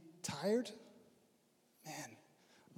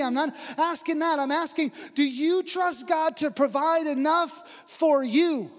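rising, louder and higher preacher's drive; fervent preaching; 8 semitones higher, 9 dB louder than usual. Acted. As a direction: build the sermon up to full force. Preaching builds in force.

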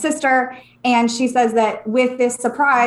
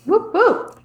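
high, bright little cheer; 8 semitones higher than usual. Real, not acted. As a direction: whoop it out with joy. Cheering the retreat announcement.

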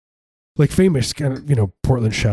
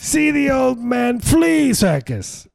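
grandiose flea circus showman; much slower than usual. Acted. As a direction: bark it like a hammy ringmaster. Ringmaster barker call again.

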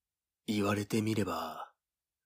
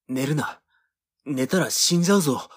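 louder, urgent, fast mock command; movie rescuer line; 5 semitones higher, 7 dB louder than usual. [name] acts out a movie mountain-rescue scene, mock urgent shouting. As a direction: ham up a desperate snowbound rescuer. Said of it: "みたいなシーンがあるでしょ".

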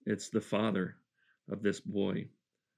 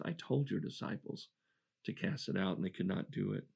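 quiet, grieved retelling; 2 semitones lower, 6 dB quieter than usual. Shown as a demonstration. Father telling Jesus the disciples failed, softly grieved. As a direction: drop much softer, letting the father's words sink. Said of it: "Instead of I, I asked, I begged".

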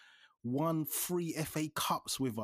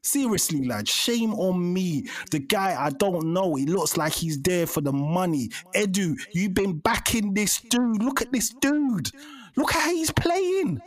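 loud, heated, scolding; tearing into a mate, loud and fed up; angry rant; 5 semitones higher, 8 dB louder than usual. Real, not acted. Ranting at his mate Edu for backing the player, loud and heated.

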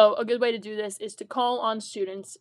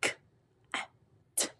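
sounding out words; 8 dB quieter, much faster than usual. Shown as a demonstration. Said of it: "When you blend the sounds in".

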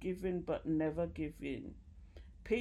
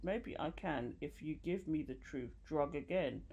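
usual speaking voice

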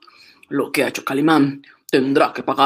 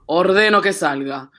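ordinary talk, nothing marked

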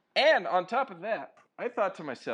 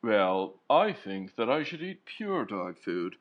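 slow, lower snooty poodle voice; uppity poodle; 7 semitones lower, slower than usual. Pretend. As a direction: drawl it like a haughty show dog. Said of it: "poodle that's uppity".

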